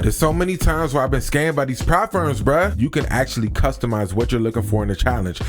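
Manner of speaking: upbeat sponsor read over music. Acted. Pitching Prop Firm Match to his channel viewers, energetic ad read. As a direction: sell it like a YouTube sponsor spot.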